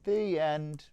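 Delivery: put-on lively rhyming read-aloud for children; story narration. A narrator's reading. Narrator reading a children's Easter tale, lively storytelling. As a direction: tell the tale with bounce and warmth in the rhythm.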